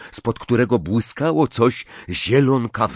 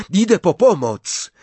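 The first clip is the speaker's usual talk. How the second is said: raised, higher call for help; calling over wind; 8 semitones higher, 4 dB louder than usual. A narrator's reading. Harry tells his friends he's going for help, calling out. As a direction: project it urgently over the wind. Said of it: "zawołał, przekrzykując wiatr".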